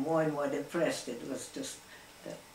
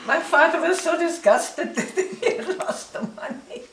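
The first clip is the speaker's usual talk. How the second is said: higher, louder words broken by laughter; laughing through it; 10 semitones higher, 8 dB louder than usual. Real, not acted. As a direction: tell it while laughing. Laughing while telling the story.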